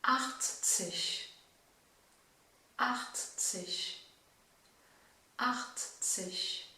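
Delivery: "Achtzig" with a throaty ch and "-zig" like "sich"; German drill. Shown as a demonstration. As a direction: repeat "Achtzig" clearly. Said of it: "je vais te donner tous les chiffres". (« Achtzig » avec un ch guttural, répété)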